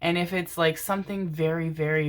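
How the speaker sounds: normal talking voice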